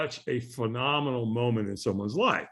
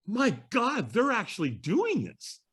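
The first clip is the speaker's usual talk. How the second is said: higher-pitched retold amazement; astonished; 7 semitones higher, slower than usual. Walker recalling first sight of the firewalk, astonished. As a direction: awestruck disbelief, drawn out. Voicing his astonished reaction.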